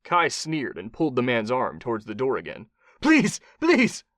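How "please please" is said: high, loud, panicked begging; pleading. A narrator's reading. Captive begs as his arm nears the door, pleading. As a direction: beg desperately. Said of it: "Mombasi pleaded".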